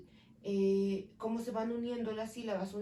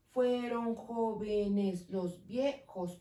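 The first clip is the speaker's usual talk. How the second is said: slow syllable count; much slower than usual. Shown as a demonstration. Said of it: "Ocho sílabas".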